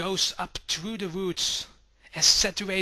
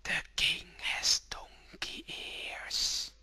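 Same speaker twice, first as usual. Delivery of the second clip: slow, airy whisper like the wind; soft as the wind; 8 dB quieter, much slower than usual. A narrator's reading. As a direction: let it drift like a breeze. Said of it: "as soft as the wind".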